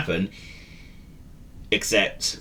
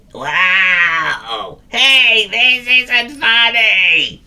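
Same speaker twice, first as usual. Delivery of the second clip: high, loud, slow protest; calling down from a tree; 11 semitones higher, 7 dB louder, much slower than usual. Acted. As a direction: shout down from the top branch. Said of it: "he called from the topmost branch".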